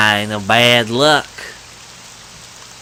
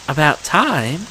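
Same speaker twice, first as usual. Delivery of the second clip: usual speaking voice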